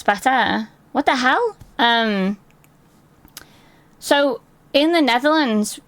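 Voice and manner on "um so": ordinary delivery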